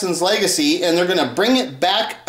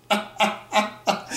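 silent wheezy laugh; 6 dB quieter than usual. Real, not acted. Laughing at Janet rapping.

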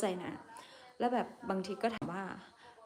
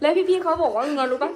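much higher and louder, laughing through the words; 7 semitones higher, 10 dB louder than usual. Real, not acted. Streamer laughing as she asks viewers what coworkers said, much louder. Laughing while telling story.

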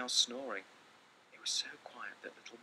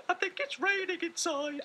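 calling little mouse; 9 dB louder than usual. Acted. Little Mouse calling his brother at night, high squeaky call. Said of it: "he called".

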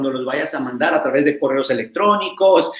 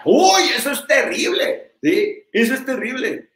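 mock horror; 7 semitones higher than usual. Real, not acted.

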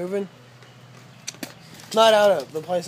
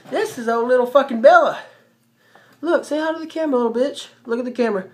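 excited dog talk; 5 semitones higher, 6 dB louder, faster than usual. Real, not acted. Showing the dog to camera.

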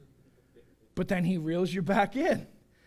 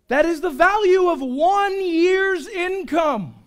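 loud, drawn-out, high proclamation; emphatic amazement; 9 semitones higher, 9 dB louder, slower than usual. Real, not acted. Preacher marveling at Mary's gift, loud and amazed. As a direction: stretch it out in raised-voice astonishment.

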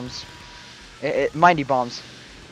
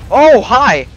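high, very loud burst of surprise; startled exclamation; 9 semitones higher, 14 dB louder than usual. Real, not acted. Player caught off guard by the blast, startled shout. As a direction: yelp it out in surprise.

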